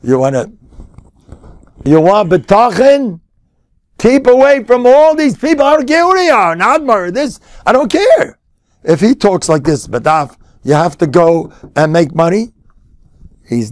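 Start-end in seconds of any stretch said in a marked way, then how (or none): 4.0-8.4 high-pitched, impassioned warning; insistent pulpit exhortation; forceful preaching. Real, not acted. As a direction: push them away with raised pitch.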